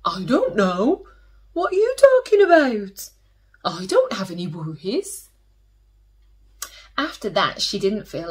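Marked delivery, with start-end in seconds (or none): 0.1-5.3 lightly voiced bored brother, brushing her off; a hint of a busy big brother; dismissive brother. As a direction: brother glued to his game, mildly dismissive. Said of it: "all he said was".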